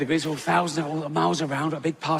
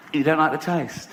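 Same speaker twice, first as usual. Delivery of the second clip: ordinary talk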